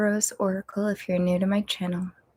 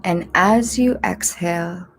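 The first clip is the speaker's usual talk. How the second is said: guided meditation. Acted. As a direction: ease them into breathing gently.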